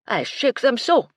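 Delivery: theatrical French chef; French accent. A narrator's reading. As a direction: demonstrate it with French flourish. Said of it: "Michel's French accent".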